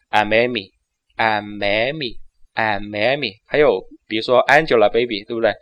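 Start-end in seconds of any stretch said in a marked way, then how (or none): 0.1-3.3 slow linked. Shown as a demonstration. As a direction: ease into the link slowly. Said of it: "就应该说". (轻声慢读连读)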